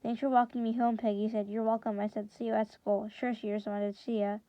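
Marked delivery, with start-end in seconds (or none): none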